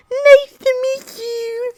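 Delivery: very high, slow and shy; shy high Harry voice. Acted. Nervous Harry meeting the Weasleys, in a high slow shy voice. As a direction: squeak it out timidly, slowly. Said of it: "That's how I'm gonna do it".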